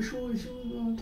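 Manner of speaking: softly voiced imitation of a mother's call; imitating mother calling. Teacher quoting his mother calling him at home, softly imitated. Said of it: "mummy आवाज दे रही थी".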